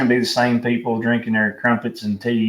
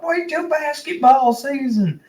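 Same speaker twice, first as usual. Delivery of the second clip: much higher, slowed mock taunt; taunting fan. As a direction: drag out a smug rival fan's taunt. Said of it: "somebody said something about".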